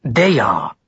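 clenched teeth, biting it out; through clenched teeth. Acted. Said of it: "she said between clenched teeth".

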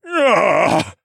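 loud high cry of pain; anguished wail. Acted. Dying regent in agony, wailing. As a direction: let a wail rip out. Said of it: "a wail ripped out of Siskorn's mouth".

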